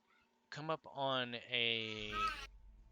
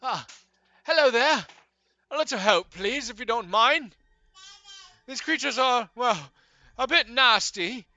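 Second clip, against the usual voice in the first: much higher and louder, a fussy polite bird-man; high-pitched owlfolk stranger; 10 semitones higher, 14 dB louder than usual. Acted. Bird-man begging the party for help, high and fussy. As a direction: chirp it out, flustered but courteous. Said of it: "he turns and looks and goes".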